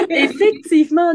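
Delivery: high, loud, laughing agreement; real laughter. Real, not acted. Host agreeing about the time-zone mix-up, laughing. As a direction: burst out laughing as you agree.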